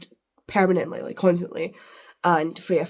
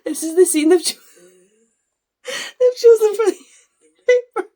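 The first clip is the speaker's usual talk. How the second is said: high, loud words squeezed through laughter; laughing helplessly; 12 semitones higher, 9 dB louder than usual. Real, not acted. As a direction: barely get it out through giggles. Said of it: "you know why I'm laughing".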